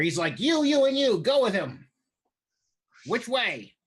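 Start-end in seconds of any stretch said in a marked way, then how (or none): 0.4-1.9 high, urgent command; goblin giving orders. Acted. Goblin orders underlings to follow. 3.1-3.7 goblin asking. Acted. Goblin asks where to go.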